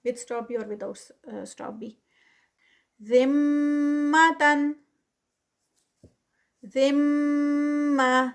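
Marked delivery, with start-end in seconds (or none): 3.0-8.3 ذمتن, then ذمہ with the تا turned into ہ and a ghunna on the میم; tajweed recitation. Shown as a demonstration. Teacher models ذمتن and its stopped form ذمہ. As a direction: chant ذمتن and ذمہ slowly, higher and louder. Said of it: "تا جو ہے وہ گول ہاں میں".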